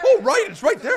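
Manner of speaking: high, loud mocking echo of the commentator; sarcastic parroting of the call.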